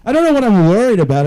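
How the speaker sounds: high, fast and loud fretting; jittery anxious rush; anxious outburst. Real, not acted. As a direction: blurt it out in a nervous rush.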